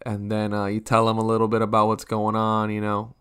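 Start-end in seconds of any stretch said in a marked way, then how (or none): none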